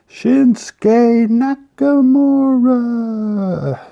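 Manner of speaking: very high, loud, long-held name; ring announcer call. Pretend. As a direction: belt out the name, stretching the last word.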